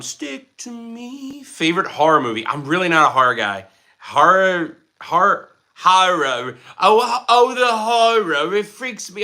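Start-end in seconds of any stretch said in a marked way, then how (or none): 0.0-1.4 high, slow drawn-out catchphrase; character catchphrase voice. Acted. Streamer reading chat, sings catchphrase in put-on voice. 6.8-9.2 slow, hammed-up theatrical lament; dramatic mock horror. Streamer jokes to chat about horror films, hammed-up theatrical lament. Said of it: "I don't know why I said it like that".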